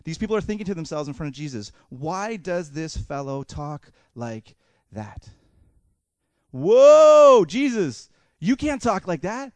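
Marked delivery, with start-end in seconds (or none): 1.9-5.3 very slow, word by word; slow, deliberate inner question; pondering thought. Acted. Religious leaders' thought, read slowly. 6.5-9.5 big drawn-out "whoa", then high protest; loud, higher-pitched mock outrage; shocked objection. Acted objection of religious leaders.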